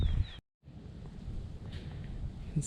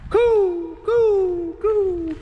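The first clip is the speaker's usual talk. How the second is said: high cooing bird sound; bird call; 4 dB louder, much slower than usual. Acted. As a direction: coo like a dove. Calling to a bird.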